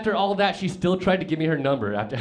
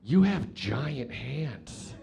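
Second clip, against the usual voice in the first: slow amazed line; 3 dB quieter, much slower than usual. Retold or imitated. First version, amazed.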